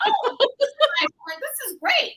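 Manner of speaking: high laughing gush of delight. Retold or imitated. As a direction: gush with a laugh over how nice it is. Excited quote of herself reading.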